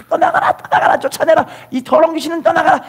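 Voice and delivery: high-pitched rapid-fire rebuke; acted casting-out prayer, voice leaping high; exorcising command. Preacher re-enacts driving out evil spirits, high urgent command.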